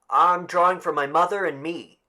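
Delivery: put-on a boy speaking up nervously.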